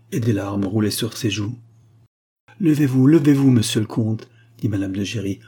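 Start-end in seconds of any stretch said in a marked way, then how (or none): 2.6-4.3 loud, raised urging; urgent exhortation. A narrator's reading. Mother tells the kneeling count to rise, urging loudly. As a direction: urge him up at once.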